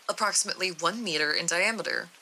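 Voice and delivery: ordinary delivery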